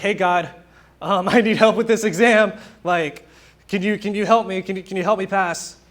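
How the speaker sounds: hammed-up desperate student prayer; mock pleading. Preacher imitates unprepared student praying before an exam, mock pleading.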